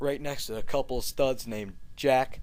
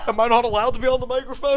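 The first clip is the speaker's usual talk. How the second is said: louder, fast, higher indignant protest; indignant shout; 10 semitones higher, 6 dB louder, faster than usual. Acted. Frank arguing with Edith, louder fast indignant protest.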